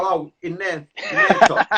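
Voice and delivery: can't keep a straight face saying it; cracking up while telling the joke; laughing through it. Retold or imitated. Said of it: "And I go".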